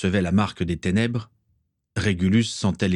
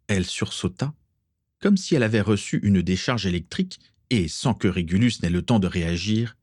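usual speaking voice

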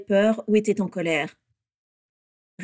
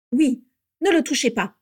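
a hint of unpleasant nasal voice, raised; nasal, disagreeable; 5 semitones higher, 5 dB louder than usual. A narrator's reading. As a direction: chime in with a nasal whine. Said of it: "renchérit Orion de sa voix nasillarde et désagréable". (voix nasillarde et désagréable)

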